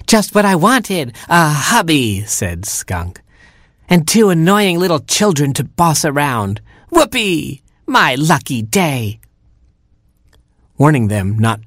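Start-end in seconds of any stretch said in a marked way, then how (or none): 0.1-2.2 high, gleeful skunk voice; gloating Skunk. A narrator's reading. Skunk on her new hubby, gloating. 3.9-9.2 high, mock-delighted crowing; gloating Skunk. A narrator's reading. Skunk on children to boss around, gloating.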